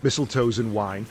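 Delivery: usual speaking voice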